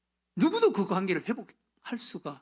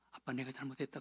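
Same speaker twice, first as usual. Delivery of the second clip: soft tearful apology; 4 semitones lower, 11 dB quieter, faster than usual. Retold or imitated. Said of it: "끌어안고 그렇게 울었다".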